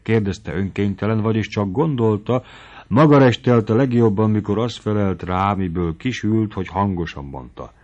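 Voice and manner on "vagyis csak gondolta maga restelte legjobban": plain reading voice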